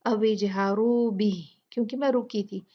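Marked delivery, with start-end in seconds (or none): 0.0-1.6 slow recited अविजहारू बिही, stopping at the end; recitation with pause. Shown as a demonstration. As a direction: chant it slowly and stop on बिही. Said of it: "मैंने इसे पढ़ा था".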